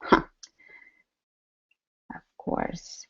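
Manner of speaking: normal talking voice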